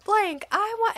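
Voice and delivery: put-on high, drawn-out child's demand; imitating angry toddler. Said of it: "she's getting mad and madder and just angry".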